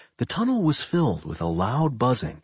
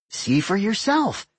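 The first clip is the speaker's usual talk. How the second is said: playful Doc voice; 10 semitones higher than usual. Acted. Doc voice, teasing.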